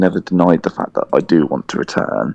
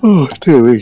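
sighing through a yawn; 10 semitones higher, 6 dB louder than usual. Real, not acted. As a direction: say it mid-yawn. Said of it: "Sorry, I'm yawning".

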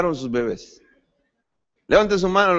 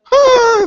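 mock cry of pain; 8 dB louder than usual.

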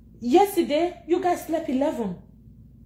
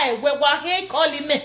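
ordinary talk

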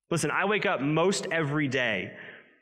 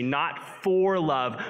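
slow, stressed "for" in "not for love"; much slower than usual. Acted. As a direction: draw out "for" to contrast it. For vs from contrast.

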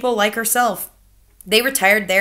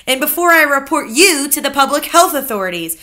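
higher, louder grandmother voice; accusing grandmother; 6 semitones higher, 7 dB louder than usual. Acted. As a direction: pin the blame on him. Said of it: "my grandmother said".

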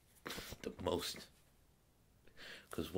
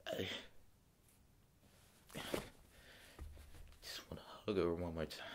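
partly whispered, choked longing; subdued grief; 4 dB quieter than usual. Acted. Father longing to hug his daughter, quietly choked.

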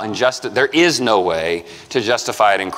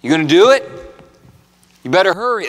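mock pushy preacher; 6 semitones higher, faster than usual. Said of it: "His next line isn't".

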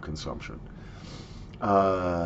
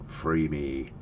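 lower, pleading voice of a stuck burp; trapped burp's plea; 7 semitones lower, 5 dB quieter than usual. Pretend. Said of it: "It just kinda sits there going".

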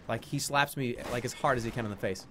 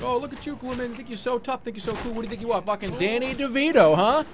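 high, taunting trash talk building to a shout; sneering ring announcer rising to a loud roar; mocking wrestling promo; 13 semitones higher, 8 dB louder than usual. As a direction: taunt your rival on the mic, then yell the entrance. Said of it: "and I'd be like".

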